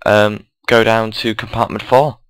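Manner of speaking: usual speaking voice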